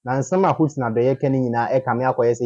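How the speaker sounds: ordinary talk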